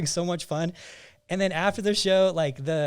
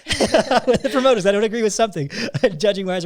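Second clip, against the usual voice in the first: laughing; 4 semitones higher, 7 dB louder than usual. Real, not acted. Laughing about yearly fights.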